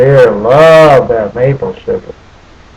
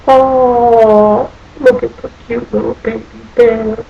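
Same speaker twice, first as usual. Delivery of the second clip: put-on cooing over a baby; 7 semitones higher than usual. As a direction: coo it like talking to a baby animal. Cooing over the cute cub.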